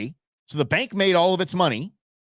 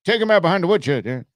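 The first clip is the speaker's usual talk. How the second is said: old man mock voice; 4 semitones lower, faster than usual.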